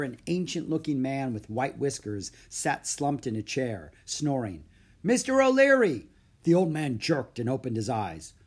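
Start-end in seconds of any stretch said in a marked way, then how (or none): 5.0-6.1 high, loud shout to wake him; calling out loudly. A narrator's reading. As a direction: rouse a dozing old man by name. Cook rouses sleeping, hard-of-hearing butler.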